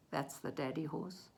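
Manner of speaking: ordinary delivery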